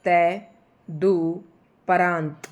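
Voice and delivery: तय, दु, परांत said as separate chunks with pauses; तय दु परांत dictated piece by piece; syllable by syllable. Shown as a demonstration. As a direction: break तय दु परांत into spoken pieces. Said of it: "ये अंक की मात्रा तदुपरांत".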